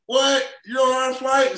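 high-pitched, loud pestering questions; nosy stranger's high, astonished voice; mock incredulous questioning. Acting airport crowd questioning him.

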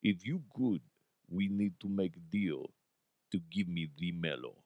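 low, soft, deadpan Russian accent; subdued straight-faced Russian accent; real Russian accent. Shown as a demonstration. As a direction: play a serious Russian businessman, low and understated. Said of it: "do a real Russian accent".

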